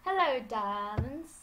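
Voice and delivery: slow, loud, drawn-out campy greeting; campy drag queen. Acted. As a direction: ham up a flamboyant diva hello. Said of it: "you wanna do this voice?".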